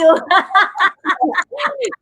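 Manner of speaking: high, bursting laugh; a real high laugh; bright laugh. Real, not acted. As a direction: laugh out freely at the thanks. Host laughs after thanks.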